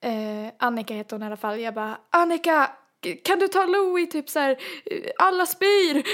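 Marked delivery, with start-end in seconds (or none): 2.1-6.0 high, loud call for help across the boat; desperate call. Retold or imitated. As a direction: shout for Annika to come take the baby. Calling Annika for help. (ropar högt och desperat på Annika)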